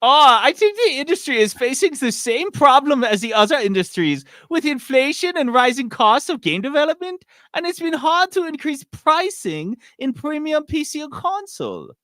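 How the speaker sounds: high, loud put-on executive accent that keeps drifting; mock a CEO in a wobbly foreign accent. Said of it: "he did it in a, in a, the accent".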